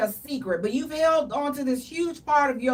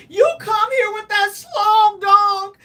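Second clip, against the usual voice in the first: higher, louder, slow hammed-up sob; mock crying; 5 semitones higher, 5 dB louder than usual. To the partner, a hammed-up crying complaint. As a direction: blubber it out like a crying baby. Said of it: "I would cry like a baby".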